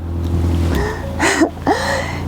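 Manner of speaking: rising giggle; laugh. Real, not acted. Laughs after the demo.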